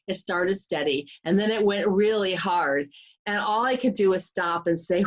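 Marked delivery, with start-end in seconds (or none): none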